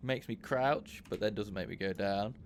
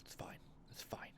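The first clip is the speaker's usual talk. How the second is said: a breathy self-soothing whisper; whispered resignation; 19 dB quieter than usual. Real, not acted. Player calming himself after raging at the game, whispering. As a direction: whisper it to yourself, giving up.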